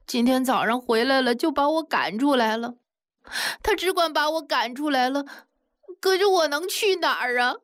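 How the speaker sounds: high, tearful pleading woman's voice; pleading young wife. Acted. Young wife begs her father-in-law to help, high and pleading. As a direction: beg the old man, voice pitched high and strained.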